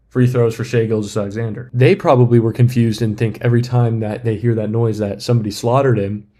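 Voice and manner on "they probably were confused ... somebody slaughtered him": usual speaking voice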